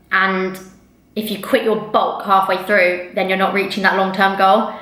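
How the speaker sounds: ordinary delivery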